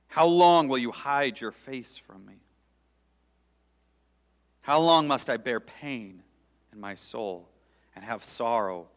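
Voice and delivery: loud, rising lament read to God; fervent psalm prayer. Acted. Preacher praying the psalm aloud before his congregation, pleading.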